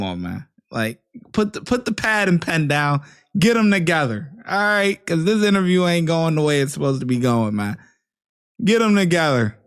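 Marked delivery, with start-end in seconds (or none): 1.3-9.6 raised, louder and higher, exasperated; mock shouting. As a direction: yell it at the screen like a coach. Commentator yells advice at officer.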